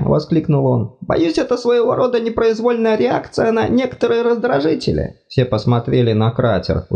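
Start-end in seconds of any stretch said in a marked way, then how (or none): 1.0-5.2 high fussy god voice, apologetic; flustered deity, much higher pitched; embarrassed god. Acted. God explains.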